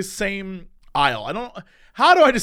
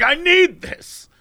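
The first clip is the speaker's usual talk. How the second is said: NEED drawn out high; mock desperate plea; 7 semitones higher, much slower than usual. Shopper pleading for his whiskey, mock desperate stress on NEED. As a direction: stretch NEED slowly, pitched up.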